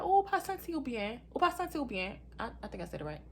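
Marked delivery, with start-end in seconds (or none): 0.0-2.2 higher-pitched imitation of mom speaking Creole; mom's Creole. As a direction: voice a concerned mother in Creole. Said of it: "she said it in Creole".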